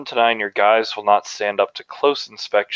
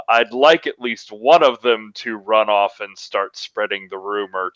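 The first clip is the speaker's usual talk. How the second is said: ordinary delivery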